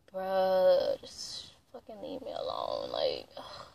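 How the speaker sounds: very low and soft, sinking to a whisper; muttered exasperation. Retold or imitated. Granddaughter pushed to hug grandmother, muttering in exasperation. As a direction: grumble it under the breath. Retold inner protest at forced hug.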